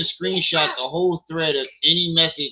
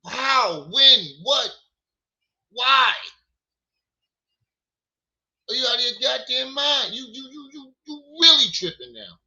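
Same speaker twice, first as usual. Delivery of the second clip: outraged; 6 semitones higher, 3 dB louder than usual. Real, not acted. Outraged rapid questions at accuser.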